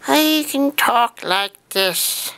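drawn-out, slow delivery. Shown as a demonstration. Vlogger shows off his voices, very slow. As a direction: stretch every word slowly.

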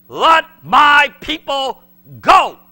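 slow, ringing proclamation to Pharaoh; commanding Pharaoh. Acted. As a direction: thunder the command at the king. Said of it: "he says to Pharaoh".

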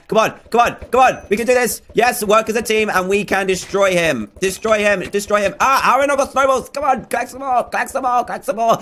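high, excited battle urging; frantic gamer pep talk; urgent rallying. Real, not acted. Urging team on mid-battle.

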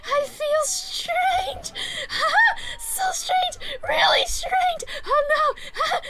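overwhelmed as if in a dream; swooning girl. Acted. A girl swooning after praise from her master, high ecstatic cries.

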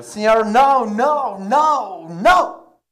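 high, loud, pleading; offended bartender. Acted. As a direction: bartender protesting to the paisano. Bartender objects.

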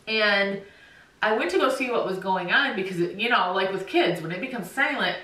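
ordinary talk, nothing marked

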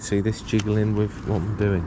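ordinary delivery

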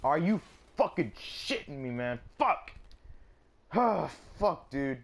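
loud, high, exasperated shout; real fury, building to a howl; furious yell. Real, not acted. Frustrated gamer yelling at an unfair death, furious.

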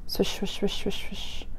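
soft unvoiced swish; swishing grass. Reading aloud, voicing grass swishing softly. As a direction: breathe the swishes like grass in wind.

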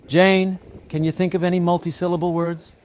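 high-pitched classroom question; teacher calling on pupil. A narrator's reading. Teacher asks Jane for an example, high teacherly call.

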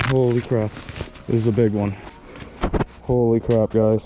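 ordinary delivery

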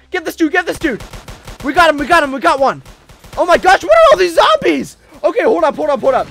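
high, loud play-acted alarm; hollering in mock panic at the attackers; excited alarm. Player spots all the zombies in a game, excited alarm.

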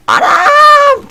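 piercing high shriek of fright; real startled scream; shrill scream. Real, not acted. Jump scare.

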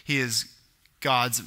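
ordinary talk, nothing marked

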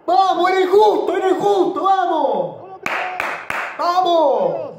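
high, loud, drawn-out cheering; shouting. Real, not acted. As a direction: yell it out for your team. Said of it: "lo grité con todo el empate".

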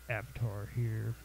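ordinary delivery

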